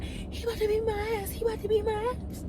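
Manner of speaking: put-on a touch of a scared girl whispering urgently; frightened girl. Frightened girl at car window.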